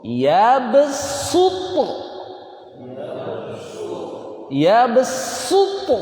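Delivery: "Ya besutu" slow and high, with a thin hissed s; sin tipis. Shown as a demonstration. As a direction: stretch "besutu" on a long thin s. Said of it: "Tipis dia". ("Ya besutu" pelan dengan sin tipis)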